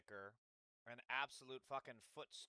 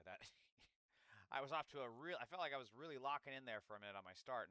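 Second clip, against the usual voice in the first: ordinary talk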